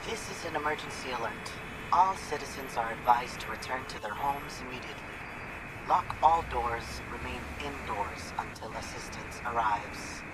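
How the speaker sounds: flat broadcast over a speaker; emergency alert. Acted. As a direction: read it like a tinny radio alert. Radio emergency broadcast plays.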